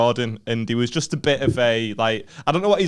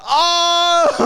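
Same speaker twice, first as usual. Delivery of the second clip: high shouted Oh; mock shout; 5 dB louder than usual. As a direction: whoop it like the crowd jeering a burn. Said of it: "they're like".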